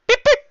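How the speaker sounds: very high, loud beep beep; mock car lock beeps; car beep imitation. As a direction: chirp it like a car alarm.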